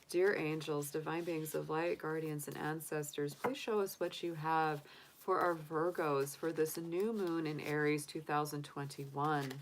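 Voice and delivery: soft, hushed invocation; prayer. Acted. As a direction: call on the angels gently. Invokes angels before drawing cards.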